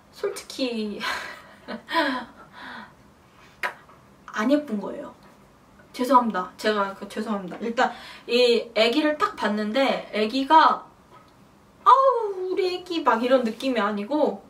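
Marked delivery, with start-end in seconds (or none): 1.7-2.2 short, breathy chuckle; sheepish laugh. Real, not acted. Mother hesitating before a frank confession, sheepish laugh. Embarrassed before admitting baby wasn't pretty. 11.9-13.0 high-pitched imitation of a doting mother; mock gushing coo. Mother mimicking expected first reaction to her baby, mock gushing.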